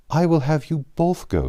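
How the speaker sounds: a mother brightly insisting everyone goes; eager insistence. Acted. Mother insisting her daughters both go, high and emphatic.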